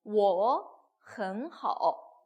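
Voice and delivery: 我很好 drawn out syllable by syllable; very slow, careful teaching read of 我很好; model pronunciation. Shown as a demonstration. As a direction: spell out each tone of 我很好 slowly. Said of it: "뭐라고 대답을 했어요".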